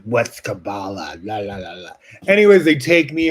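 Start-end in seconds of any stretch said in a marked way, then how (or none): 0.0-1.9 rude mocking. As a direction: sneer at the word like a rude cop. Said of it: "rude, like".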